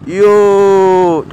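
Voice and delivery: ordinary talk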